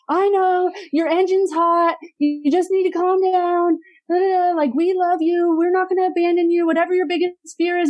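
overdone therapeutic parent. Retold or imitated. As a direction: imitate a parent overdoing the calming talk. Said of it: "therapeutic parenting overdone".

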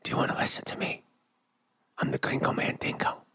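hushed, voiceless ASMR parody; jokey intimate whisper; mock ASMR whisper. Shown as a demonstration. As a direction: whisper it very softly into the mic. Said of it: "I'll do one of those A D M things".

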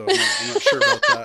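real burst of loud, high laughter through the words; laughing. Real, not acted. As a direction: crack up while talking. Joking about the co-speaker's name.

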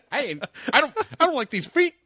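very high, loud, frantic comic voice; Eduardo Murphy impression. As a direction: squeal it out flustered and defensive. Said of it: "Eduardo Murphy impression ever".

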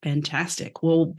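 usual speaking voice

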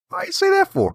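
put-on high-pitched Southern-accented retort, half joking; Southern accent quip. Baltimore guy with a Southern accent, asked where he's from, answers in a high Southern twang. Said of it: "apparently I have a Southern accent".

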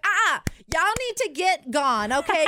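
loud, high sassy telling-off; the hooker scolding the guys, loud and sassy; sassy protector. Retold or imitated. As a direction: shoo them off with attitude.

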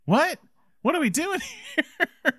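high, laughing exasperation at the plot; incredulous. Retold or imitated. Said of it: "And you're like".

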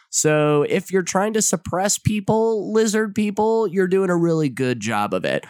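dry sarcastic praise; mock admiration, clearly insincere. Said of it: "I say that sarcastically".

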